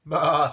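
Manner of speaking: loud bleating baa; loud sheep bleat. A narrator's reading. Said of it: "said the sheep".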